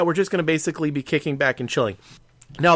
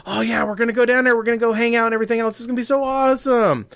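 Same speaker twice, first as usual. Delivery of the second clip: much higher, fast, giddy mock gushing; gushing excitement; 10 semitones higher, 4 dB louder, faster than usual. Host imitating a friend's husband, giddy and gushing. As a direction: play the overeager husband bubbling over. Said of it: "her husband is actually just like".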